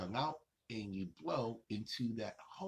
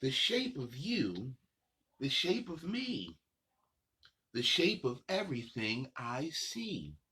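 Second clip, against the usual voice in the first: sing-song picture book reading, unhurried pauses; reading to children; 4 semitones higher than usual. Acted. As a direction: read the rhymes to little kids, savoring each line. Reads picture book aloud.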